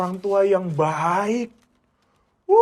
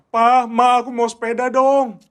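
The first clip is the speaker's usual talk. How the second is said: put-on high, loud child pleading for a bike; whiny child asking; 8 semitones higher, 6 dB louder than usual. Child begging Pa and Ma for a bike, high and loud pleading. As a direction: beg your parents like a kid. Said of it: "Kalian bilang".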